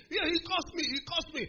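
high, insistent mock complaint; very angry. Big student still protesting to the crowd, high mock outrage. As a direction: keep wailing it as the bully. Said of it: "the guy got very angry".

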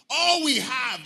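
loud, high outburst of complaint; complaining. Acted. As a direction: exclaim it as an indignant grievance. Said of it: "They began to complain".

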